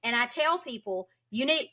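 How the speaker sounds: ordinary delivery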